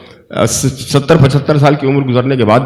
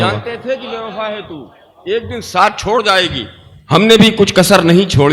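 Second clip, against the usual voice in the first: softer, higher repeat of the couplet; poetry recitation; 5 semitones higher, 3 dB quieter than usual. Acted. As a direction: repeat the verse gently for effect.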